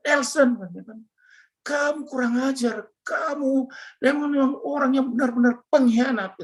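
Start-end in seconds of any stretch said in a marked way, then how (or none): 0.1-0.6 raised, mock-tearful cry of a name; retold tearful wife. Wife phones her husband in tears, imitated tearful outcry. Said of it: "istri saya nangis". 1.7-6.4 retold tearful accusation. Wife on the phone accusing her husband of betrayal, imitated tearful reproach. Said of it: "istri saya nangis".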